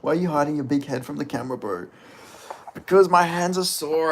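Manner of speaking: usual speaking voice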